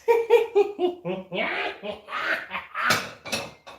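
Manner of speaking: long manic giggle breaking into cackle; unhinged giggle. Acted. Releasing insanity with nobody judging, mad giggling. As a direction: giggle on and on like a madman. Laughs alone after insanity remark.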